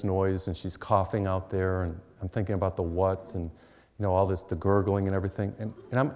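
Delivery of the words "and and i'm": normal talking voice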